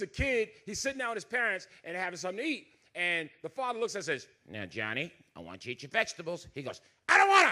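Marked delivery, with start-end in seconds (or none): 4.5-6.5 much lower, softer patient father; a mild, soft-spoken dad coaxing his son; calm dad voice. Retold or imitated. 7.1-7.5 put-on higher, louder bratty child refusing; whiny kid.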